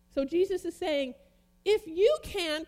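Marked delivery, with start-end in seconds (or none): 1.6-2.7 slow, loud, high "you can"; challenging question. Retold or imitated. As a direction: throw "you can" back as a pointed challenge. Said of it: "question mark".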